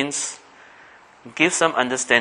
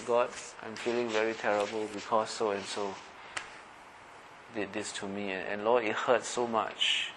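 hushed hurting prayer; 9 dB quieter than usual. Pretend. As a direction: pour out the hurt quietly to God. Model heartfelt prayer.

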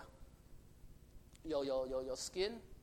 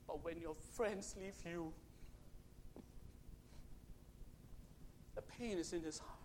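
hushed; 5 dB quieter than usual. Real, not acted. Solemn pain of abandonment.

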